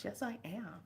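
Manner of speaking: soft whisper, imitating the elder; whispered quote. Retelling the elder's reply, whispered. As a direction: whisper it back like the old lady. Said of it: "She goes".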